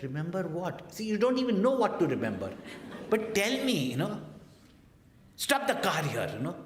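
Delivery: loud, high and fast mocking rant; exasperated wife. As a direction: fire it off, half laughing, fed up. Wife's retold angry outburst.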